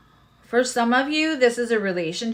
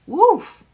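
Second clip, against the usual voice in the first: sharp, high, loud "woof"; startled woof; 13 semitones higher, 11 dB louder than usual. Real, not acted. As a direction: let out a sudden loud whoop of breath. Reacting to intense card energy.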